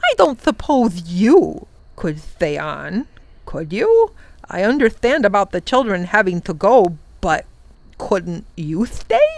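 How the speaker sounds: a royal beast gently begging a friend to stay; pleading Wangdoodle. Acted. The Wangdoodle asks the professor to stay, hopeful.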